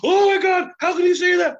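high-pitched, loud mock shriek; hammed-up shocked outcry; horrified teacher. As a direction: overreact like a scandalized teacher. Teacher's shocked reaction, acted.

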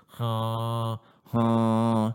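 nasal demo sound. Shown as a demonstration. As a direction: let air through the nose. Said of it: "something like this".

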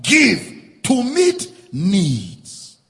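"Give to meet needs" very slow and loud, every word hit; slow emphatic punch. Real, not acted. Punchline of the sermon point.

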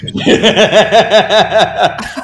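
high, loud burst of laughter; hard laugh. Real, not acted. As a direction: crack up laughing. Cracks up at own story.

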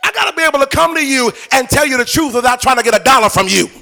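high, loud, driving sermon; impassioned preacher in full cry; full-force preaching. Real, not acted. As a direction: declare it boldly to the whole congregation.

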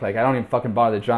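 normal talking voice

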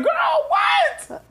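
high shrieked disbelief; shocked girl what. Retold or imitated. Reading a rude comment, high shocked shriek.

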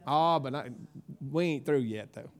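ordinary delivery

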